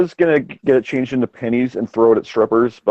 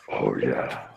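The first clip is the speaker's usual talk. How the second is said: very low, soft demon rumble; 12 dB quieter than usual. Pretend. Co-host showing off a smoked-out voice, demonic. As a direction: drop right down into a quiet demon voice. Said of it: "my demon voice is very on point".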